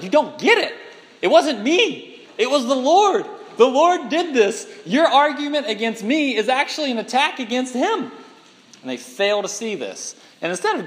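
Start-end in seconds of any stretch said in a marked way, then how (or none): 0.0-8.1 louder and higher, insisting it wasn't him; exasperated Moses. Retold or imitated. Moses correcting the grumbling people, exasperated and raised. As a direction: set them straight, hammering the Lord. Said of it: "And Moses is like".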